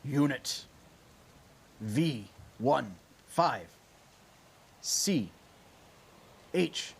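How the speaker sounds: cold machine voice over the storm; mechanical voice. Acted. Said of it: "a mechanical voice".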